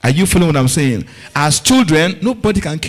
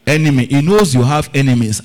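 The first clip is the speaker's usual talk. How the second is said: usual speaking voice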